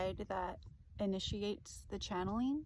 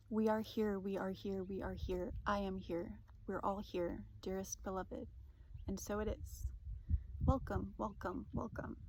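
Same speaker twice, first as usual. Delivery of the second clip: channeled message reading; 5 dB quieter than usual. Acted. Reading a spirit guide's message to viewers, soft and solemn. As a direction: deliver it like a calm oracle, soothing.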